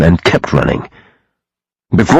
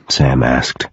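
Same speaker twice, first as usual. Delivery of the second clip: usual speaking voice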